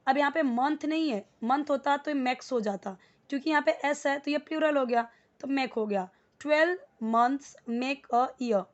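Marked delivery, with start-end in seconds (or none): 6.4-8.6 very slow and clear; slow model sentence. Shown as a demonstration. Teacher modelling the sentence slowly to the class. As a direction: lay the sentence out word by word. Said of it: "repeats model sentence".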